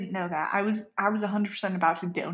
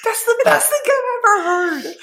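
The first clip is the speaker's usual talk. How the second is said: much higher, louder, near tears; squealing with joy; overjoyed cry; 13 semitones higher, 7 dB louder than usual. Real, not acted. Hearing her dinosaur was named, overjoyed and crying out.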